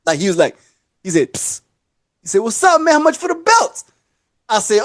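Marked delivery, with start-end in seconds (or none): 1.3-1.6 short unvoiced "psst" for attention; imitated psst. Customer beckoning the belt seller, imitated hushed psst. 2.4-3.9 high-pitched customer voice; imitated customer. Customer asking a mall seller for prices, lightly imitated high voice.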